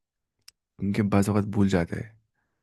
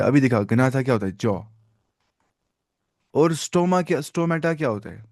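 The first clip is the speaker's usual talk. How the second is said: ordinary talk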